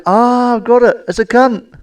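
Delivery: put-on excited child guess. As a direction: shout it out like a proud little girl. Daughter finally guesses the drawing.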